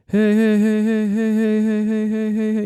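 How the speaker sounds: high quick heys, fading; fast eighth-note echo. Shown as a demonstration. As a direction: rattle off heys like an eighth delay. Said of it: "one eighth would be like".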